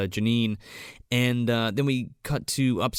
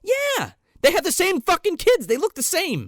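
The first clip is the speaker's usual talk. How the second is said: much higher, louder insisting; real excited arguing; excited insistence; 4 dB louder than usual. Real, not acted. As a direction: argue your theory with rising excitement. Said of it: "arguing insistently with friend".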